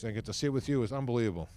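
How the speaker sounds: ordinary talk, nothing marked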